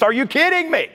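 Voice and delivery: high, sharp disbelief. Real, not acted. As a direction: yelp it out, incredulous. Preacher reacting to survey numbers.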